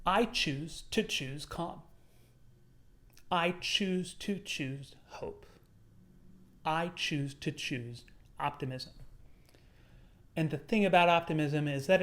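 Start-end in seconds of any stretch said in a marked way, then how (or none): 0.1-8.9 calm mantra. Acted. Calm mantra, slow and soft.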